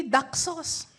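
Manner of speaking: imitated British "Duck sauce" with no R; British accent. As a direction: say "Duck" with a long British vowel, R dropped. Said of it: "British accent". (puntong Briton sa "Duck sauce")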